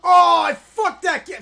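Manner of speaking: loud, high burst of anger; sudden gamer rage; frustrated outburst. Real, not acted. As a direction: blurt it out in frustration.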